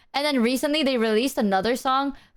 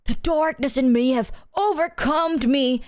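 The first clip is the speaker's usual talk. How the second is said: mock edgy lyric; 4 semitones higher than usual. Imitating an edgy song lyric for chat. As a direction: ham up a dark lyric. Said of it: "the lyrics would be like".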